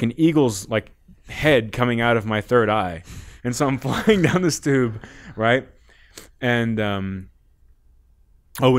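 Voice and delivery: usual speaking voice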